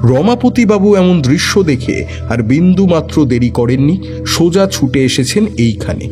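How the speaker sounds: ordinary delivery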